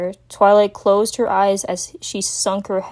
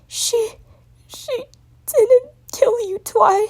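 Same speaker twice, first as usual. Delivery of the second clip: high, halting crying voice; struggling through tears; slower than usual. Acted. Spike struggling to tell Twilight. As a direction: force each word out through sobs. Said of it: "struggled to speak up".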